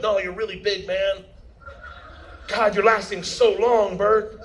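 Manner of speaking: a hint of an imitated flatterer; mock flattery. Voices flattering director in threesome.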